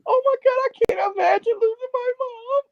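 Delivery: put-on high, tearful child's wail; retold child sobbing over a movie, pitched high; crying child. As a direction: wail it like a frightened nine-year-old girl. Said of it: "And I was crying".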